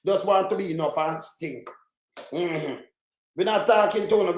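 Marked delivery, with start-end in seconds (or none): none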